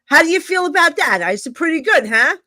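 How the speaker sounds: high, loud, excited retelling. Retold or imitated. Psychic retelling her excitement to friends, high and loud. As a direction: play up the thrill of the reading.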